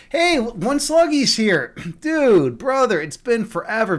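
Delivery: high, stretched-out happy hello; excited greeting. Real, not acted. Greets a returning viewer in chat.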